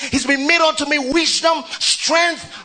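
high, ringing sermon cadence; preaching at full force. Acted. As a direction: preach it out to the whole church. Preaching the promise to the church.

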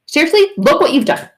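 high, loud playful blame; hammed-up outraged scolding; mock accusation. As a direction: scold in exaggerated disbelief. Mocks blame phrase while laughing.

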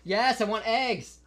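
high, drawn-out call to another room. Real, not acted. Answering dad who interrupts the recording, calling out.

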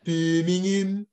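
exaggerated Birmingham accent; Brummie accent. Shown as a demonstration. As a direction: drag out the city name in Brummie. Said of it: "You've got Brummie".

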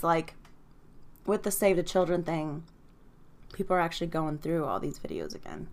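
ordinary talk, nothing marked

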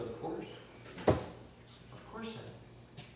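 hushed admission. Retold or imitated. Dad's quiet admission in the dark.

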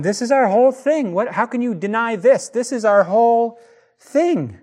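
high, loud exasperated outburst; hammed-up exasperated protest; imitated freak-out. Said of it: "pulling out his hair and freaking out".

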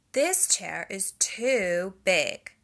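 put-on loud, slow little-girl protest; Goldilocks exclaiming. A narrator's reading. Goldilocks sitting in the big chair, loud slow exclamation. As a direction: make the chair sound enormous. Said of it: "she exclaimed".

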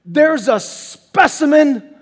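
loud, slow, impressed wow; amazed exclamation. Acted. Jesus marveling at Nathanael, loud and drawn out. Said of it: "Jesus says of him".

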